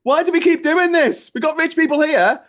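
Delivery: loud, high-pitched exasperated outburst; exasperated rant. Retold or imitated. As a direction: plead loudly at the top of your range, fed up. Acted outburst over the film industry.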